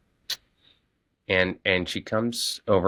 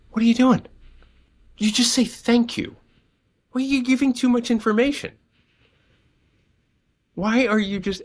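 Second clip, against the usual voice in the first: much higher and louder, scolding in disbelief; exasperated wife; 5 dB louder than usual. Retold or imitated.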